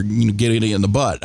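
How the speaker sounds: ordinary delivery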